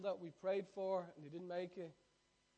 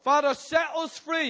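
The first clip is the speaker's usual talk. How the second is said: fervent prayer shout; 12 semitones higher, 20 dB louder, slower than usual. Acted. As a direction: shout the prayer high and loud. Call and response prayer.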